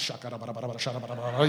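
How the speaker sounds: fast mock babbling in tongues, low and soft; panic tongues. Preacher mocking his own panicked tongues. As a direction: rattle off fake tongues in a panic. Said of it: "in panic tongues".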